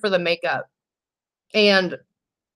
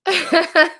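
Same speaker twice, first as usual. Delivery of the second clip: a quick, high giggle; 9 semitones higher than usual. Real, not acted. Reading a joking comment, she giggles up high. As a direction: let a short high laugh slip out.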